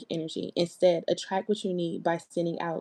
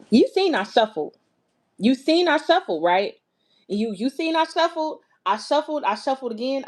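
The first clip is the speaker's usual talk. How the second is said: higher and louder, pressing the point; insistent; 7 semitones higher, 4 dB louder than usual. Real, not acted. Reader insisting to viewers she shuffled, high and loud. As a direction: insist loudly that you shuffled.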